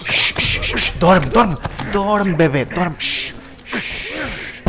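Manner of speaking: shushing a baby to sleep, mock lullaby; whispering over a crib. Mock lulling a zombie asleep.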